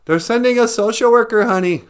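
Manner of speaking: high, loud mock reassurance; husband taunting his wife. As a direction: sneer it to the wife. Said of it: "All right".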